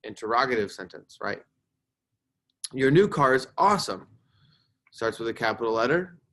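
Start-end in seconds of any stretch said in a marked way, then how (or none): 2.7-4.1 louder, enthusiastic exclamation; exclaiming. Shown as a demonstration. Teacher reads an exclamatory example aloud, exclaiming. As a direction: exclaim it with admiring punch. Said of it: "So that sentence is an exclamatory sentence".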